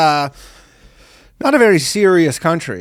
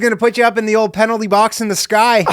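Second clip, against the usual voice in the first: raised, shouted mock Canadian; Canadian accent; 5 semitones higher than usual. Canadian translator warning smokers in hockey slang, Canadian accent. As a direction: belt it out as a hammed-up Canadian. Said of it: "They have a Canadian translator".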